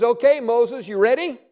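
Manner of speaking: a playful God asking if he's ready; eager call. Acted. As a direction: rouse him up for the task. God voiced cheerfully to Moses.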